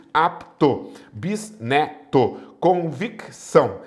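"Apto, bisneto, convicção" split slowly between the consonants; syllable by syllable. Shown as a demonstration. Teacher showing where consonant clusters split, syllable by syllable. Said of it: "Separam-se os encontros consonantais".

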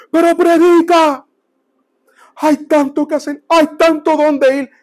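put-on high, pleading Argentine old man; weeping old Argentine. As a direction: imitate a tearful Argentine evangelist urging a younger man. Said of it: "el hombre argentino".

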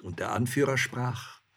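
ordinary delivery, nothing marked

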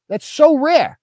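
"so" lifted high in mock astonishment; mock amazed. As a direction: push it up into a fake gasp of wonder.

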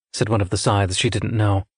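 plain reading voice

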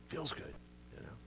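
whispered, mocking aside; sarcastic whisper. Real, not acted. Preacher mocking feel-good sermons, whispering. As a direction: hush it as a snide aside.

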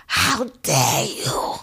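hushed, breathy dare you; whispered. Real, not acted. Vowing off the rapper, hushed disgusted whisper.

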